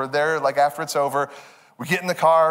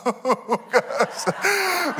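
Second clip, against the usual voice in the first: high, drawn-out sigh of relief; exasperated relief; 8 semitones higher than usual. Retold or imitated. Dad at the gas pump after chaotic night, high exasperated relief. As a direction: let the tension out.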